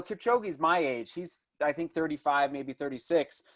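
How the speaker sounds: ordinary talk, nothing marked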